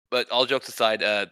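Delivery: normal talking voice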